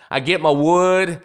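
ordinary talk, nothing marked

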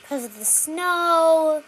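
SNOW stretched long, loud and high; first take, SNOW slowly swelling; drawn-out SNOW. Shown as a demonstration. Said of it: "Three very different things".

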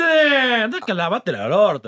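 slow, high, put-on scornful voice; hammed-up high scornful jab; mock insult. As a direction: drag it out with mock disgust.

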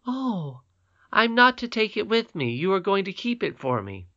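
put-on high, eager child's voice full of wonder; a delighted little girl admiring a crown; little princess Irene. A narrator's reading. Irene marvelling to her grandmother, in a high childlike voice.

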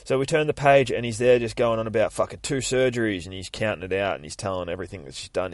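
ordinary talk, nothing marked

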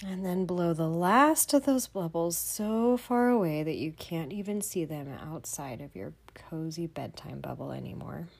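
normal talking voice

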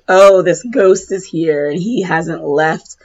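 ordinary talk, nothing marked

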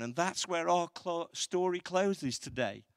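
normal talking voice

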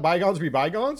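"bygones" said as "by-GONZ", high and loud; odd pronunciation of bygones. Real, not acted. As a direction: stretch the end of "bygones" into a rounded "gonz". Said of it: "Why are you saying it like that?".

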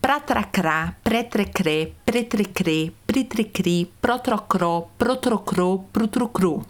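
pratracrá to prutrucru, each syllable crisp; crisp, clear syllables in sequence; articulation drill. Shown as a demonstration. Teacher demonstrating the pra-tra-cra drill. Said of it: "Repita com todos os sons de vogais".

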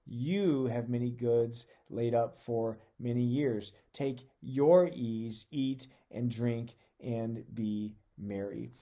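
slow, deliberate words, each "I" and "my" leaned on; emphasized scripture reading. Shown as a demonstration. Pastor stresses the rich man's words to his congregation, slow and weighty. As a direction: read it slowly, pressing the rich man's self-focus. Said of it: "I'm gonna emphasize certain words".

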